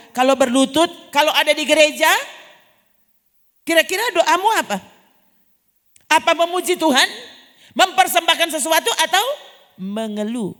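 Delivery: full-force sermon; fervent preaching. Acted. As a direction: drive the question home to the congregation.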